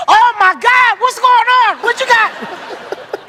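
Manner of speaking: very high, frantic questions; panicked. Retold or imitated. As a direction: squeal it in disbelief. Dealer dealing at blackjack.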